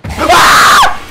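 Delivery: shrill panicked scream; terrified scream. Real, not acted. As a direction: scream in sheer terror. Jump scare.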